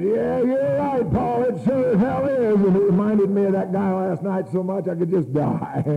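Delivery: very high, wailing agreement; hammed-up outburst. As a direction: wail it in exaggerated misery. Acts out his outburst at Paul.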